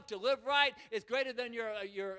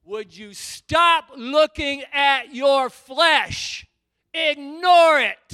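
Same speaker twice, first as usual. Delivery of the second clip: slow, booming shouted rebuke, much louder; God screaming; 4 semitones higher, 19 dB louder, much slower than usual. Retold or imitated. God screaming at the preacher to stop looking at his flesh, slow booming shout. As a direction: roar each word slowly, right in his face. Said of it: "he was screaming at me".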